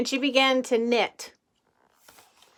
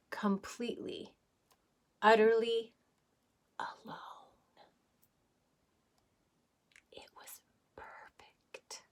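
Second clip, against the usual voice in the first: whispered; 7 dB quieter than usual. A narrator's reading. Reader hushing to show the void's silence. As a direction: let it sink to a whisper.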